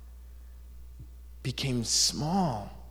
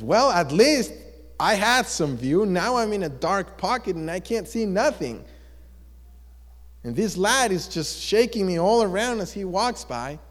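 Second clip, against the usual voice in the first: higher-pitched indignant whining; seriously complaining; 4 semitones higher than usual. Acted. Rock in a boy's dark pocket, seriously complaining. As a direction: gripe in a raised, aggrieved pitch. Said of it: "now seriously complaining".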